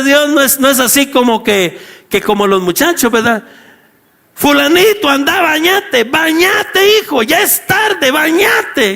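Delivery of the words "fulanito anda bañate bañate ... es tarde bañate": put-on higher-pitched parent urging a child along; badgering mom-or-dad voice, pitched up; nagging parent. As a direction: call out insistently like a parent chasing a kid.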